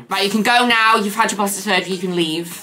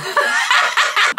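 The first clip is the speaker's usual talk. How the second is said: airy laugh up high; high breathless laugh. Real, not acted. Vlogger laughing at her own cough, airy high laugh.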